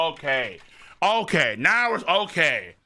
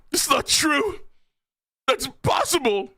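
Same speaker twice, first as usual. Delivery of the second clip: higher shouted denial; hammed-up shocked character; mock disbelief; 8 semitones higher than usual. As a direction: cry it out in shock.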